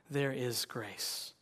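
slow, hushed and tender; soft slow reassurance; soft refrain. Acted. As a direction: let it land gently, almost a sigh. Sermon refrain, softest.

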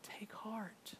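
gentle. Acted. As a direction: speak it gently, like comforting a scared child. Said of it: "he very gently says".

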